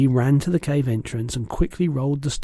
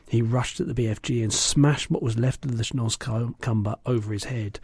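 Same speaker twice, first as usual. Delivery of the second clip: usual speaking voice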